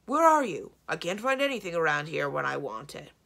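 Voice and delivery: calling out loudly across the castle; husband can't find things, loudly calls his wife. A narrator's reading. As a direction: holler for her, fed up.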